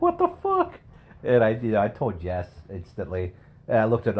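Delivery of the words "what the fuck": much higher shocked what the fuck; squeaky disbelief. Retold or imitated. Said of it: "I was like".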